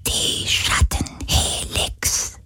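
knowing ghostly whisper. Acted. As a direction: breathe the name with understanding. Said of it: "flüsterte der Geist des Meeres verstehend". (verstehendes Flüstern)

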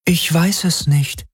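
high, drawn-out, regretful female voice; regretful Helix voice. A narrator's reading. The Shadow Helix answering Galen, regretful. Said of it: "Sie klang bedauernd".

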